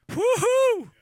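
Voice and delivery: high, loud woo-hoo of triumph; celebratory whoop. Acted. Preacher celebrating his own small progress, high triumphant whoop. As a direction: whoop it up over a small win.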